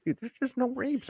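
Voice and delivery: high, soft mock letdown; small, high disappointed voice; retold disappointment. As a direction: imitate someone quietly let down. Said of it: "sounded like he was disappointed".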